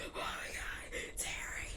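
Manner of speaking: frightened whisper; panicked whisper. Retold or imitated. Retelling Wanda finding Terry shot, panicked whisper. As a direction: breathe it out in panic. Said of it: "She like".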